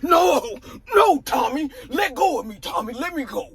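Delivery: high-pitched, hammed-up pleas; mock pleading. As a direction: plead to be let go, playing it up. Acted pleading while pinned to wall.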